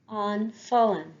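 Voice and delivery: ordinary talk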